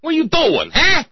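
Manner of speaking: high, loud mock cop shout; mock cop yell. Driver cop grabbing the dancer, high loud mock shout. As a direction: bark it as the rough cop. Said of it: "the driver who roughs him up".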